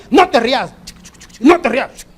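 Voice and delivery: put-on high, loud parent telling the child not to laugh; imitating a father who tickles and forbids laughing; mock scolding parent. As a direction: bark it like a father tickling his kid.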